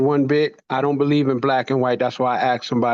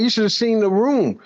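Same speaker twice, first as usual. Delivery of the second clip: ordinary talk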